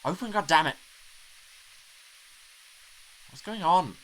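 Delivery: loud, high panicked shouting. Acted. Trapped driver claws at the car door, panicked shout. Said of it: "it was just my panic".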